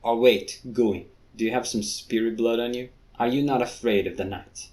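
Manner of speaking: ordinary talk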